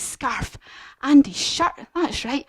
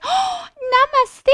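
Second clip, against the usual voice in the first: high, slow thankful giraffe voice; grateful giraffe. Acted. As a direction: say it slowly, high up, beaming thanks. Happy giraffe thanking George.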